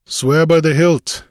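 high, loud command; commanding demand. A narrator's reading. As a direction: demand an oath from the crew. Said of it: "Conan demanded".

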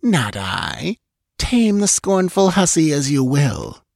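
scornful. Acted. Salome scorns her sister.

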